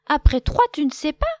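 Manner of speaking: loud high teasing; mocking. A narrator's reading. Vache mocking Loup, loud teasing. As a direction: tease him loudly.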